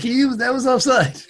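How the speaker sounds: imitating a commentator crying offside; mock commentator. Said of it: "The commentator was like".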